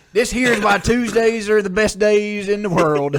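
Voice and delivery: loud, high hammed-up country accent. Reading review title for laughs, loud country accent. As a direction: holler it like a country boy, in accent. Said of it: "do it in a country accent".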